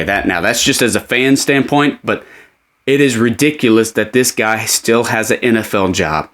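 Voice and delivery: usual speaking voice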